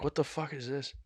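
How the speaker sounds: soft half-asleep murmur; groggy quiet confusion. Retold or imitated. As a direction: mumble it half awake. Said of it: "I'm like".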